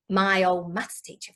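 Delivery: ordinary talk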